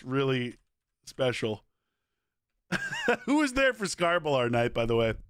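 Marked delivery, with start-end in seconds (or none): 2.7-3.2 squeaky high laugh. Real, not acted. Streamer squeaking a laugh about Scarbalar night.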